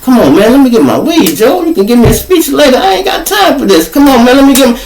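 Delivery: high, hurried pleading; impatient pleading. Retold or imitated. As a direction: rush him high and impatient to hand over the weed. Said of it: "I'm like".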